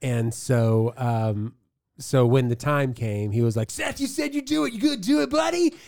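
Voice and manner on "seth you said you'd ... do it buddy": high, chummy youth pastor. Retold or imitated. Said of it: "That's a great youth pastor voice".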